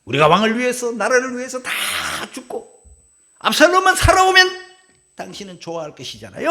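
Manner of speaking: 크게 터뜨리는 직언, 압살롬에서 높고 크게; 왕에게 서운함을 쏟아내듯; 요압의 질책. Acted. 요압의 직언을 재연. (요압이 왕에게 따지듯 큰 소리로 직언)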